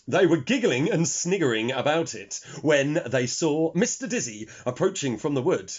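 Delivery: ordinary delivery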